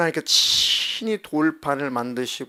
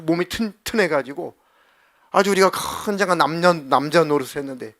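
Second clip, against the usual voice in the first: loud, higher nostalgic grumbling; whining complaint; 3 semitones higher, 6 dB louder than usual. Acted. Israelites pining for Egypt's leeks and garlic, loud whining.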